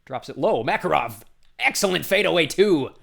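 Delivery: thrilled commentator calling the score; excited play-by-play call. Real, not acted. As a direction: build quickly into the made basket. Commentator calls a scoring play.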